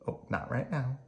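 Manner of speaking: ordinary delivery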